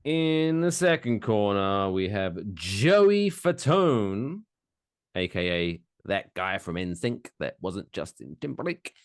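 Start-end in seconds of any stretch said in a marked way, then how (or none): 0.0-4.4 slow, high boxing announcer hype; big fight-night introduction; ring announcer. Acted. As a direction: drag out the names like a ring announcer.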